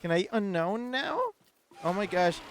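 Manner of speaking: high, loud, thrilled burst; excited squeal. Real, not acted. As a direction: squeal at finding a rare one. Spots rare Pokemon, squeals.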